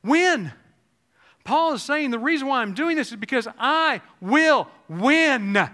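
high, loud, driving sermon voice; fiery preacher lifting the congregation; full-force preaching. Acted.

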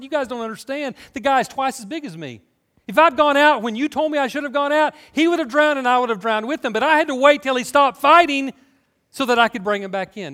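high, insistent explaining; imitated defensive protest. Lifeguard answering the crowd that blamed him, acted defensive protest.

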